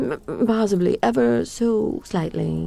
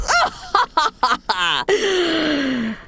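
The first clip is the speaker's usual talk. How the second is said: high triumphant villain cackle; evil cackle; 9 semitones higher than usual. Acted. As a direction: cackle over your victim. After casting the spell.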